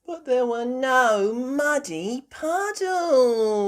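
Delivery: high, loud, drawn-out lament; Peppa. Acted. Peppa missing her puddles, high drawn-out complaint. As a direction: stretch it into a child's wail.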